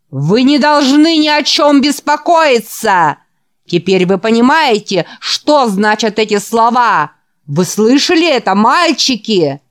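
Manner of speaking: loud, high revival preacher voice; fiery preaching. Acted. The preacher works up the boys in the hall with fiery preaching. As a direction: whip up the congregation.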